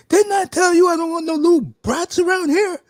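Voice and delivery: loud, high mock scolding; wrestler in character. Wrestler scolding a mother about her brats, in-character shouting. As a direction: bellow it like a wrestler berating a mother. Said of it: "he went back into character".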